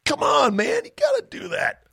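high, far-off egging shout; mock pep shout. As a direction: cheer him on like a rowdy buddy.

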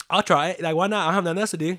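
eager yes. Retold or imitated. Quoting his own eager reply.

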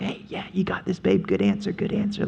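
soft, offhand husband egging his wife on; a lazy cheerleader of a husband; husband cheering her on. Retold or imitated. Husband murmuring encouragement to his wife, mock supportive.

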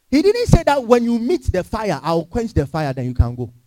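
ordinary talk

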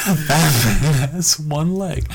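slow, drawn-out statement of fact; matter-of-fact. Retold or imitated. Turning to Jesse to announce it, matter-of-fact. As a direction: state it slowly, as plain fact. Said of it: "it was just like a fact".

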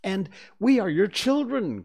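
put-on high and loud children's cry; eager cry. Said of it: "cried the twins".

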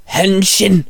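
high-pitched heroic transformation call. A narrator's reading. Shinzo triggers his transformation, calling out. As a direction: call it out like a battle command. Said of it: "Shinzo called out".

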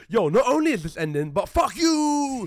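loud, high taunt with a long drawn-out "you"; mock defiant taunt. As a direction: hurl it like a gleeful insult. Voices the show insulting its audience.

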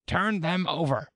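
high, loud angry order; angry royal order, pitched high. A narrator's reading. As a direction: command it as an angry queen. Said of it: "The queen turned angrily away".

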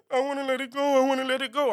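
high-pitched little whimper of a plea; mock-pitiful high voice; sad, scared pleading. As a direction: imitate a frightened, pitiful brother. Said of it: "just so sad and scared".

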